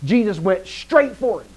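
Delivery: high, loud, drawn-out impression of a teacher from Mississippi; emphatic Greek teacher. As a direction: imitate a teacher hammering the verb. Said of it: "my Greek teacher used to say things like".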